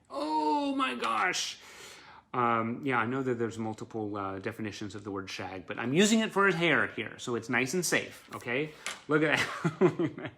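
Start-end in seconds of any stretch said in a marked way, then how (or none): 0.1-1.6 high, very slow swooning sigh of delight; swooning gush. Real, not acted. Fan gushing over Simon's shaggy hair, high and stretched. 9.1-10.4 laughing delight. Real, not acted. Laughing over the photo.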